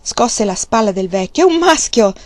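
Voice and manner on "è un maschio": high, loud triumphant cry; proud new father exulting; triumphant exclaim. A narrator's reading. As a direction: wake him with the good news. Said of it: "esclamò trionfante".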